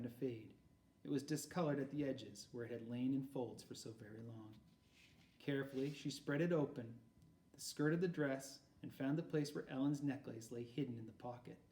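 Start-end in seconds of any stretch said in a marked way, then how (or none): none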